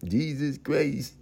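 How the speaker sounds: slow, slurred Stallone mumble; Stallone impression. Comic doing Stallone for the crowd, slow slurred mumble. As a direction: drawl it like Rocky. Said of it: "Turn to fucking Sylvester Stallone".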